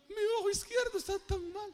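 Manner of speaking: high-pitched, whiny pleading; mock plaintive complaint. Believer complaining to the Lord about an eye, mock whining. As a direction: put on a high, pitiful complainer's voice.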